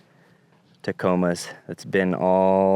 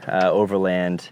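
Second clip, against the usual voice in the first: normal talking voice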